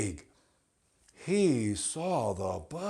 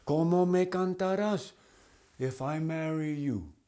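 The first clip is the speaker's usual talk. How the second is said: butterfly voice; 6 semitones higher, faster than usual. Acted. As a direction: squeak it out as a dainty butterfly. Butterfly voice again.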